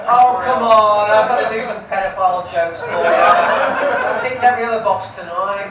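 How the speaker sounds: slow, loud, laughing "come on"; laughing plea. Real, not acted. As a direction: coax the crowd while laughing. Pleads with the crowd, laughing.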